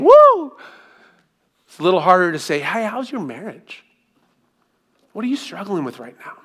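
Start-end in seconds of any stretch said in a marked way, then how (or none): 0.0-0.6 loud, high whoop; football cheer. Retold or imitated. Preacher mimics a fan cheering the game, loud high whoop. 2.6-6.4 gentle caring question. Retold or imitated. Asking a friend about struggles.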